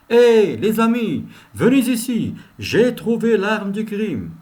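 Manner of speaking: loud, slow call to the team; detective calling colleagues over loudly. Shown as a demonstration. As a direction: call the others over, excited. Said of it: "Il peut dire, par exemple".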